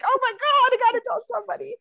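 high, fast, breathless excitement; excited. Retold or imitated. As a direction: squeal it out in a rush. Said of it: "So I was like".

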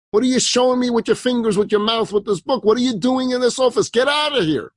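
high-pitched angry scolding; enraged. A narrator's reading. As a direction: tear into an intruder, voice pitched high. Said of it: "this woman more enraged".

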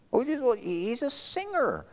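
very high protesting voice; objecting. Acted. Preacher acting objector defending a singer, high protest. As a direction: plead the case in a high voice.

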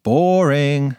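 ordinary delivery, nothing marked

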